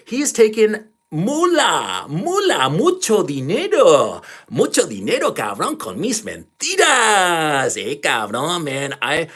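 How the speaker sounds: hammed-up Mexican accent, drawn out and high. Vlogger mocking a man taking donations for Ukraine, hammed-up Mexican accent. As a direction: put on an exaggerated, singsong Mexican accent. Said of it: "I'm doing a Mexican accent".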